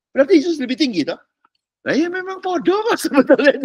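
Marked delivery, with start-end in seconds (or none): 1.8-3.6 very high pitch breaking into real laughter; laughing. Real, not acted. Preacher sharing a joke with listeners, laughing. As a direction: laugh your way through the admission. Laughs while joking in sermon.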